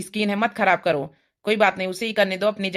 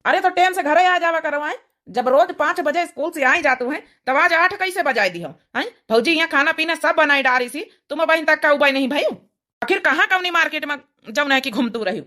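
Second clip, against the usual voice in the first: scolding village husband; 6 semitones higher than usual. Acted. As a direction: berate the late wife in dialect. Husband scolds wife late home.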